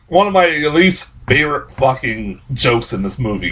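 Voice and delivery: ordinary talk, nothing marked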